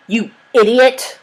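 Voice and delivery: angry scream. A narrator's reading. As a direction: scream the insult. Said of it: "screamed Ratcliffe".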